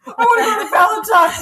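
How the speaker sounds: very high, loud whine; hammed-up bawling demand; mock tantrum. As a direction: wail it like a spoiled child. Co-host joins the angel's tantrum.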